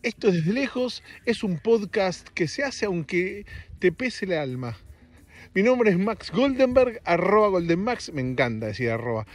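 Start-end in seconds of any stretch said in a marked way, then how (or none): 0.0-8.1 higher, slow announcer-style show opening; podcast intro. Acted. Host opening his podcast Desde Lejos as an announcer.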